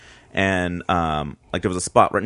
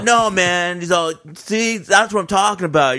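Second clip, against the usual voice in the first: mock preachy friend; 7 semitones higher than usual. Friend mocking the skinhead.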